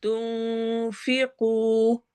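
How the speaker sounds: slow, drawn-out tajweed chant; Quran recitation. Shown as a demonstration. Teacher recites verse for students, slow tajweed chant. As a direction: recite it slowly, stretching each sound. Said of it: "नून को छुपाना है".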